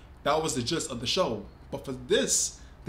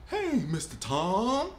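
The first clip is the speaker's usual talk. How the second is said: put-on slow, drawn-out southern accent to a superior; imitating the cartoon's southern maid greeting the cat slowly; deferential southern greeting; 5 semitones higher, much slower than usual. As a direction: stretch it out humbly in a southern accent. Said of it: "talking to Tom, the cat, like he was above her".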